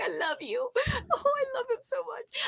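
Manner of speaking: high cooing voice; gushing high baby talk to a toy. Retold or imitated. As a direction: coo at the plush mouse. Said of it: "I was like".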